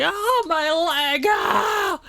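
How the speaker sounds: high, slow pained cry of a shot soldier; wounded soldier gasping. Acted. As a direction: yelp like you just took a bullet. Acting a shot soldier dummy.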